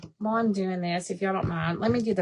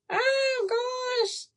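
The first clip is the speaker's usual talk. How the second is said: high, startled oh gosh; mortified squeal; embarrassed shriek; 14 semitones higher, slower than usual. Real, not acted. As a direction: yelp in embarrassment. Embarrassed at misheard word.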